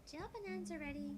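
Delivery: put-on high, bright girlish character read; cheerful Mayuri. Player voicing Mayuri explaining the timer, high and chirpy. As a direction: explain the microwave like a sweet helpful girl.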